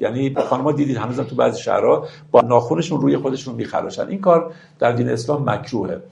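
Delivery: ordinary talk, nothing marked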